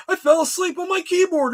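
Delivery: high, mocking parody of the excuse. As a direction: pitch it up to ridicule him.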